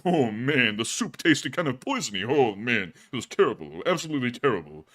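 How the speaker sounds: put-on complaining comedian, pitch swooping up then sinking; hard-luck comic. Comic griping about his CIA jobs, exasperated shtick. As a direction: grumble through a no-respect routine.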